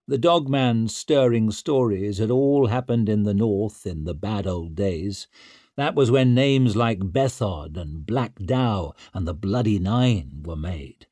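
ordinary delivery

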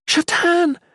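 very high, loud cry; desperate yell. A narrator's reading. Shalane calling on Shatan, desperate yell. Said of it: "the Shalane yelled".

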